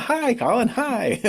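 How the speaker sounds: imitating high, falsely cheerful hello; forced happy. Friends back from crying in the bathroom, faking cheer. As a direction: put on a happy face with a little laugh. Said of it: "They put on this happy face again".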